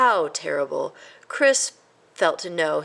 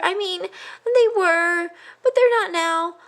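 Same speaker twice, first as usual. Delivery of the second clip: high, slow, flustered; flustered mouse; 13 semitones higher, 4 dB louder, slower than usual. A narrator's reading. A flustered mouse corrects himself, high and slow. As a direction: explain haltingly.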